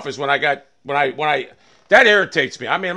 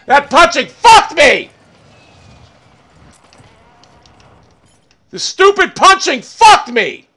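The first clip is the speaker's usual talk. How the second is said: slow, high, loud angry yell; furious shouting; 5 semitones higher, 6 dB louder than usual. Real, not acted. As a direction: draw out each furious word at a high pitch. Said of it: "I'm fucking pissed off".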